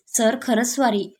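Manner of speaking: usual speaking voice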